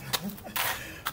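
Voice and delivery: short, low voiceless chuckle; amused laugh. Real, not acted. Coach laughs at his own late timing, amused chuckle. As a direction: chuckle softly to yourself.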